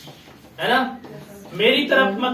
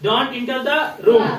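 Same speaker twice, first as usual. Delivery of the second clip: slow model sentence; much slower than usual. Shown as a demonstration. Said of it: "teacher models sentence".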